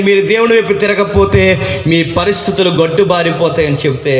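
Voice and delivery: usual speaking voice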